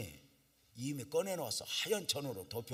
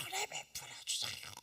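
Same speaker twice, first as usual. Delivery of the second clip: 은혜 cried much higher, rising; high crying plea; 4 dB quieter than usual. Retold or imitated. Pastor crying out the plea again, higher. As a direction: lift 은혜 high and plead. Pleading prayer repeated higher.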